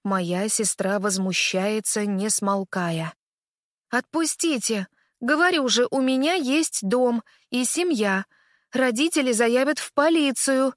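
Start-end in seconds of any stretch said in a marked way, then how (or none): 3.9-10.7 loud, indignant shouting. Acted. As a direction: yell to be let go. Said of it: "кричит она".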